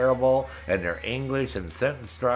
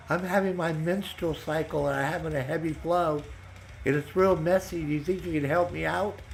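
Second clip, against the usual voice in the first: higher, sweetly pleading mock lady; mock woman's voice; 8 semitones higher than usual. Scammer woman asking him for money, high mock plea. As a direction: play her as a high, needy scammer.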